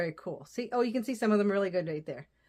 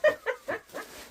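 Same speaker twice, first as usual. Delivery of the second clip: laugh. Real, not acted. Laugh while finding ribbons.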